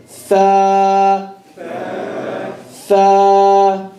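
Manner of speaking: Fa. light, lip on the top teeth; a light, breathy Fa; Arabic letter fa. Shown as a demonstration. Teacher modelling the light letter for students. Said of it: "Be careful saying fa".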